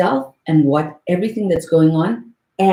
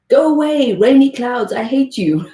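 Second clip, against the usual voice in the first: higher, louder playful tantrum at the clouds; mock angry sky; 7 semitones higher, 4 dB louder than usual. The sky pretending to shout the rainy clouds away, mock angry. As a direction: throw a hammed-up fit at the rain. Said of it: "The sky doesn't go".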